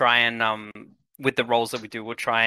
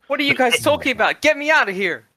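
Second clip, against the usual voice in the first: put-on high, loud, frantic American accent; trapped man yelling in an American accent; 12 semitones higher, 5 dB louder than usual. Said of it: "I have an American accent".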